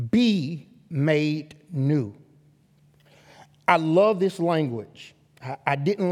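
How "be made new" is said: drawn out, each word weighed; slow it right down for emphasis; be made new, stretched and deliberate; slow emphatic repeat. Shown as a demonstration. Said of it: "Since you have been made new".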